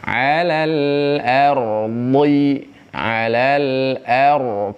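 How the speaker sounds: "alal ardi" stretched with a thick r; slow recitation. Shown as a demonstration. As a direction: chant "alal ardi" slowly with a heavy r.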